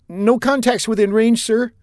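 high-voiced, jittery officer reporting; lieutenant reporting to captain, high and nervous; nervous young lieutenant. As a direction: report while still shaken.

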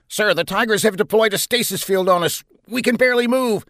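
high, strained-sounding report; alarmed. A narrator's reading. Trapped captain reporting to his commander, alarmed. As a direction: report trouble with rising alarm.

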